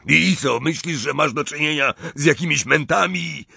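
indignant character shout; yelling. A narrator's reading. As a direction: hurl the question at the official. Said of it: "wrzasnął Gaunt".

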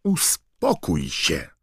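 powolne, dobitne upomnienie; lekko stłumiony krzyk. A narrator's reading. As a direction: krzyknij z umiarem, przeciągając słowa. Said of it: "krzyknął Harry". (zniecierpliwiony, powolny okrzyk)

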